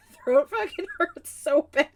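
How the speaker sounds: normal talking voice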